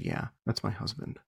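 quiet, offhand retold admission; nonplussed. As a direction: drop it softly, as if it's nothing. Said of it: "she seemed nonplussed at this".

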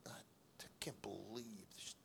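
muttering fed up leaving the meeting; muttered disbelief. Retold or imitated. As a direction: breathe it out under your breath, fed up. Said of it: "with a little bit of attitude".

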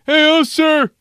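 high, loud pestering holler; acted high-pitched shout at a stranger; calling out. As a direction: holler for a passer-by's attention. Voices homeless man calling out.

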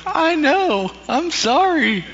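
put-on boy sobbing an apology to his mom; crying child. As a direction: wail it up high like a bawling eight-year-old. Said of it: "I cried".

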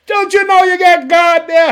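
high, loud preacher's cry; fervent exclamation. Real, not acted. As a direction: cry it out at full preaching pitch.

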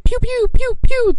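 high, loud mock gunshot sounds; pew pew gun noises. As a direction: squeak out silencer shots. Imitated silenced gunshots in skit.

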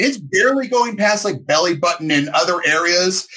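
usual speaking voice

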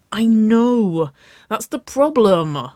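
high, loud, stretched-out frustrated wail; grumbling. A narrator's reading. Woman frustrated over what to wear, grumbling. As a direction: complain with long dragged vowels. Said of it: "Waverley grumbled".

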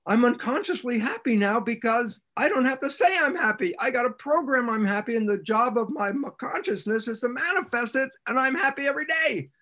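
high, lifted voice of joy; excited realization. Retold or imitated. Playing the person who suddenly finds himself happy, high and eager. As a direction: sound amazed at your own happiness.

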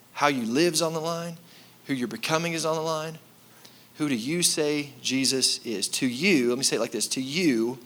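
ordinary talk

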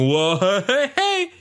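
a startled whoa jumping to a squeaky high hey; a cartoon rabbit squeaking in surprise. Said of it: "the rabbit starts going".

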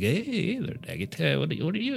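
lightly voiced friendly dwarves; a call of surprised hello; cheery dwarf greeting. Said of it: "Some of 'em kinda like".

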